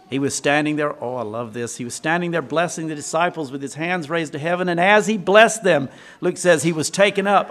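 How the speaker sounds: ordinary delivery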